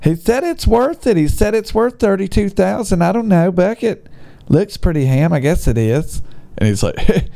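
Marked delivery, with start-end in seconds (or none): 0.0-6.2 voicing the wife, pitched higher; a hammed-up patient wife humoring her husband; lightly mocking wife voice, higher. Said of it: "And his wife's like".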